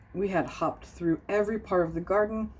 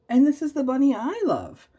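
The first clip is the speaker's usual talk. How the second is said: bunny declaring love; 10 semitones higher, 5 dB louder than usual. A narrator's reading.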